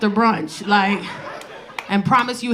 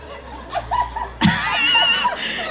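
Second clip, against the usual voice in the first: shrieking burst of laughter. Real, not acted. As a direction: throw back a high squealing laugh. Big laugh at the crowd.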